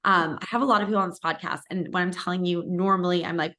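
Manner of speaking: ordinary talk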